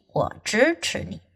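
ordinary delivery

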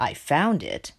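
low, dignified reply. A narrator's reading. Rat answering Pig's accusation, dignified. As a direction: keep composed under accusation. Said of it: "said Rat in a dignified voice".

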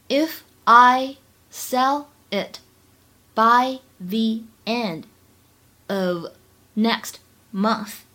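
slow, separated words with pauses. Shown as a demonstration. Teacher modelling a line for English learners, word by word. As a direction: spell the sentence out slowly, chunk by chunk.

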